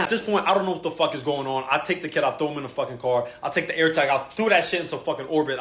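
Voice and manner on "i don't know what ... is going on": ordinary talk, nothing marked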